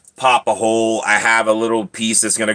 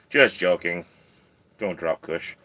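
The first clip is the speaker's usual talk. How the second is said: ordinary talk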